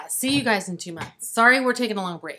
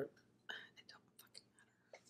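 whispering; 34 dB quieter, slower than usual. Real, not acted. Aside muttered under breath.

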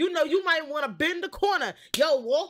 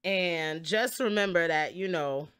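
sincere; 12 semitones lower, 4 dB quieter than usual. Real, not acted. Serious message at end.

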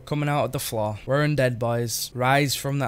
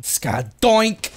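hyped catchphrase; 7 semitones higher, 5 dB louder than usual. Pretend. Battle catchphrase.

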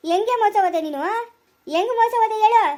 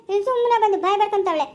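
ordinary delivery

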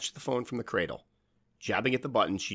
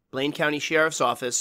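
nasal voice; 8 semitones higher, 7 dB louder than usual. Acted. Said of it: "said the nasally voice".

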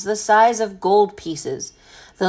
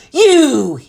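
high, loud accusing shout; captain thundering at the boy; bellowed; 6 semitones higher, 7 dB louder than usual. A narrator's reading. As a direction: bellow it in anger. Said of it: "he bellowed".

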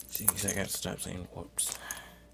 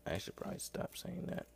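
whispered aside; 8 semitones higher, 7 dB quieter than usual. Real, not acted. Self-aware aside.

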